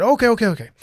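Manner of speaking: retold hurried reassurance; placating doctor. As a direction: imitate a doctor heading off an argument. Doctor cuts off his arguing.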